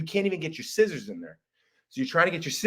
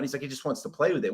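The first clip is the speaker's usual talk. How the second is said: normal talking voice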